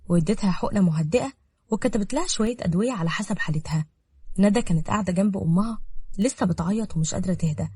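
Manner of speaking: ordinary delivery, nothing marked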